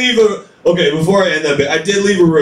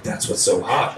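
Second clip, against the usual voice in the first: low sultry murmur; 8 semitones lower, 8 dB quieter than usual. Retold or imitated. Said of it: "And she was like".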